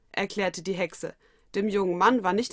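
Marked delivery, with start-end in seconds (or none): none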